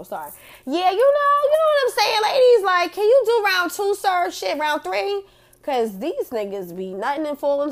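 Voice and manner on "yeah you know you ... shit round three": much higher and louder, fired up; excited rant. Real, not acted. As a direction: hype the ladies up loudly. Heated rant to ladies.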